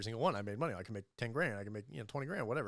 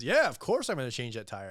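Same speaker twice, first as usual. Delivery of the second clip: loud indignant; 3 semitones higher, 9 dB louder than usual. Retold or imitated. Husband's proud reply.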